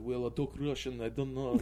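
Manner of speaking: rambling mock-Russian gibberish. Actor asked to do a little Russian, rambling mock Russian. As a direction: bluff through some fake Russian. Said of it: "started, like, rambling".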